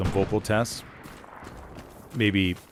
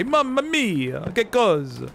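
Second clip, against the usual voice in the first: much higher, slow, exaggerated Italian accent; 12 semitones higher, slower than usual. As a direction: ham up a cartoon Italian exclamation. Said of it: "the classic Italian stereotype".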